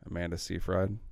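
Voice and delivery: Seyfried said SEE-fried, rhyming with fried; unsure pronunciation. Real, not acted. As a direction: try Seyfried as "SEE-fride", quietly. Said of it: "I don't know how to say it".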